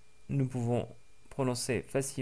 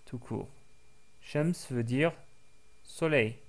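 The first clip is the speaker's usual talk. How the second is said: ordinary talk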